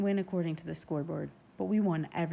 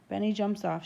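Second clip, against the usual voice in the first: plain reading voice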